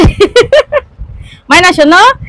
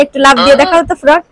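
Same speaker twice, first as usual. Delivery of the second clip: ordinary talk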